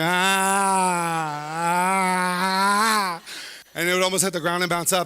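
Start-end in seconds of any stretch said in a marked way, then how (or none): 0.0-3.2 long open-mouthed "ah" wail; mock drooling cry. Crying toddler with saliva hanging, drawn-out mock wail. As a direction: bawl in long, held fake sobs. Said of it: "you had saliva hanging from your".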